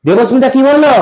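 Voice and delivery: normal talking voice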